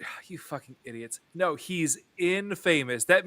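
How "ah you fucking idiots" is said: muttered insult. Retold or imitated. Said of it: "he's just like".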